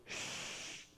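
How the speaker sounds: long hushed shush. Acted. Crowd leaders silencing the blind man, soft shush. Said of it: "shushing you".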